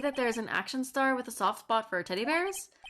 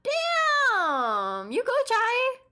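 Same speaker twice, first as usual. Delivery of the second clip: excited cheer; 13 semitones higher, 7 dB louder, much slower than usual. Real, not acted. Cheering friend's hidden skill.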